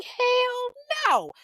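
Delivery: hell no flung up very high and drawn out; a mother refusing to give up her child, in a high shriek. Retold or imitated. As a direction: scream it up high in disbelief.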